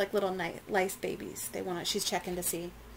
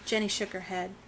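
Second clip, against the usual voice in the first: ordinary delivery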